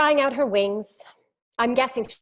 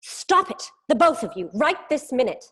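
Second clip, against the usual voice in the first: stern teacher shout; 3 semitones higher, 4 dB louder than usual. Acted. Teacher breaking up fight.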